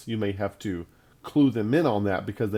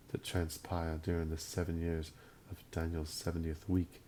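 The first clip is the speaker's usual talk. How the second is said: imitating Gavin Finley; 4 semitones lower, 11 dB quieter than usual. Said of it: "he's got this accent and he talks like".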